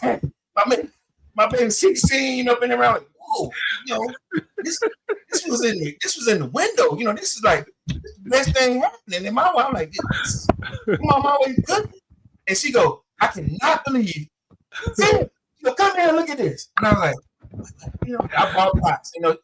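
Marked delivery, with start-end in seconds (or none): 3.2-9.3 put-on 1 pleading kid. Kid pleading about the gift. 9.9-12.0 1 flustered inner thought. Retold or imitated. 13.2-16.7 1 mom's outraged call. Retold or imitated. Mom calls dad over.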